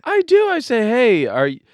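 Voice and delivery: very loud, high-pitched insistence; high, loud protest. Real, not acted. Husband defending his check-in texts, high loud protest.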